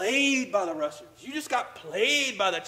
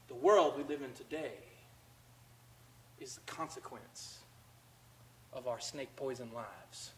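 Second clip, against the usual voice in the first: quiet reflective preaching; 7 semitones lower, 15 dB quieter than usual. Acted. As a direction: draw them in with a near-whisper. Quiet reflective sermon passage.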